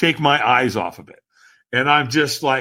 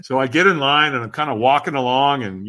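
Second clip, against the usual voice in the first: ordinary delivery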